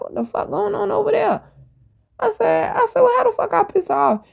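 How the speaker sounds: very high-pitched, incredulous questioning; retold indignation. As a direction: act out baffled outrage at the fuss. Retold indignant reaction to coworker's outburst.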